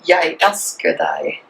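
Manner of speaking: Jeg elsker deg said slowly in Norwegian; careful Norwegian Jeg elsker deg; Norwegian pronunciation. Shown as a demonstration. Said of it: "how do you say it".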